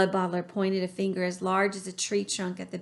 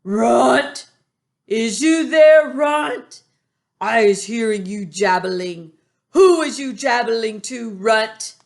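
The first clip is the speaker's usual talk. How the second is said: very loud giant bellow; thunderous shout; 3 semitones higher, 11 dB louder than usual. A narrator's reading. Bloodbottler roars at the BFG from outside. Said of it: "a voice like thunder shouted".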